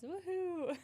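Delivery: excited cheer. Real, not acted. Celebrating monetization news.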